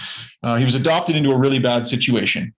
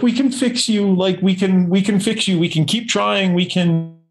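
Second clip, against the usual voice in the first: higher, pleading mom voice, a touch of tears; imitating tearful mom; 6 semitones higher than usual. Mom in tears begging her son to keep trying, pleading. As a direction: plead like a mother who can't let go. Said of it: "and she's in tears".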